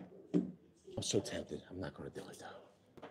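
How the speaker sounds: hushed whisper to the camera. Real, not acted. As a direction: whisper it like a secret. Unvoiced aside to camera.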